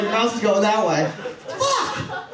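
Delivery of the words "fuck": high-pitched burst of exasperation; hammed-up cry of frustration; frustrated curse. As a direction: let the curse explode up high. Acted frustration at the mouse.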